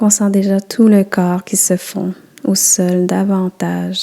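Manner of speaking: ordinary talk, nothing marked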